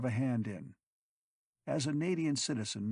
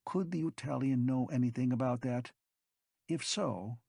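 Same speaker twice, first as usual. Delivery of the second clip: usual speaking voice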